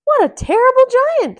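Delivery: high, loud, quick dismay; Mickey aghast, high and quick; dismayed woodcutter. Acted. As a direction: gasp it as the shocked hero. Mickey thinks aloud.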